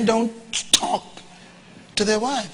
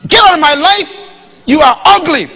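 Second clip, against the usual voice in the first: loud, sharp, higher dismissal; harsh brush-off; 5 semitones higher, 7 dB louder than usual. Pretend. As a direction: slam the door on her with your voice. Telling off a sweetheart by phone.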